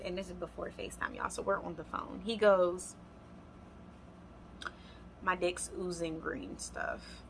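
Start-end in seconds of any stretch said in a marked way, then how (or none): 5.2-7.2 a touch of deadpan, softer and slow; dead serious. Retold or imitated. Boyfriend on phone confessing, mock serious. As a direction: deliver it flat and grave, like a confession. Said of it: "with all seriousness".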